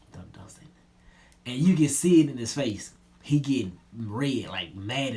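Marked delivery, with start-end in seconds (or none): 0.1-0.7 hushed warning under the breath; whisper. Retold or imitated. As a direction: whisper it so Smith can't hear. Warning Scrap quietly beside him.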